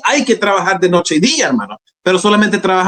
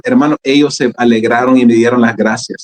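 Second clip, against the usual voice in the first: ordinary delivery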